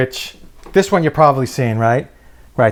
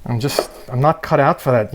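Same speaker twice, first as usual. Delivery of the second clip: usual speaking voice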